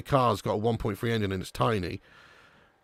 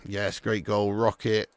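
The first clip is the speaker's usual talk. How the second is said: ordinary delivery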